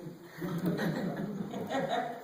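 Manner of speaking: soft breathy laugh; embarrassed laugh. Real, not acted. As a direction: laugh off the praise shyly. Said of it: "What is she talking about".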